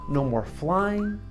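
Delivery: ordinary delivery, nothing marked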